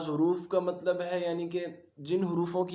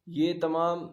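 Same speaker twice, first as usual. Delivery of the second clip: ordinary talk, nothing marked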